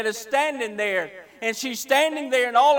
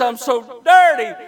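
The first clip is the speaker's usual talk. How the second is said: anguished cry; 9 dB louder than usual. Acted. Woman voiced, crying out again.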